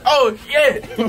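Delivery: laughing exclamation. Real, not acted. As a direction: blurt it out through a laugh. Reacting to hot wing.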